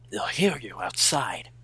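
ordinary talk

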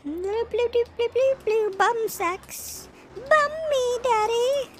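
very high mocking falsetto; high falsetto goof. Streamer goofs in a very high falsetto for chat. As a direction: squeal it way up high.

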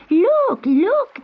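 put-on high, loud children's calls; excited shout. A narrator's reading. Children shouting from the bank at a kitten in the river, high excited shout. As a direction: shout it high to alert everyone. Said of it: "they shouted".

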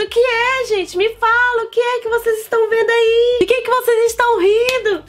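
high, giggly innocent asking; naive self-quote. Retold or imitated. Asking friends why they laugh, high and naive. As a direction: play your clueless past self.

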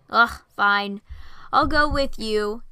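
loud, slow, put-upon giving in; dramatic sigh. Acted. A man grudgingly agreeing to escort the girls, dramatic. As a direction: heave it out like a martyr. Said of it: "Eugene sighed dramatically".

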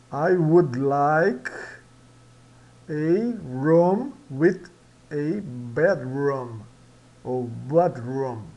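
each word drawn out slowly; slow recap reading. Shown as a demonstration. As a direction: model the sentence slowly for repetition. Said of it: "Vamos recapitular". (recapitulação lenta, palavra por palavra)

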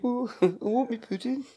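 high, slow Brummie accent, laughing; drawn-out mock Birmingham accent. Shown as a demonstration. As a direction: whine it out in comic Brummie. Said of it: "Brummie's quite easy".

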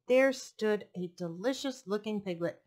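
plain reading voice

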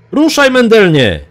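high, loud shouted command; urgent yell. A narrator's reading. Achillios urges Mendeln to flee the beast, yelling. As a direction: yell to get him moving now. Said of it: "wrzasnął Achillios".

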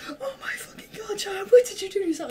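high, breathless whispered panic; horrified whisper. Retold or imitated. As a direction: gasp it out in horror, way up high. Mom sees the burn.

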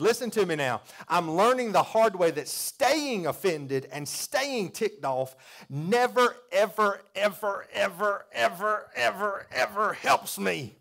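loud, high, slow hammering of each ever; full-force preaching. Real, not acted. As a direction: pound every ever home to the church. Preaching climax, repeated ever.

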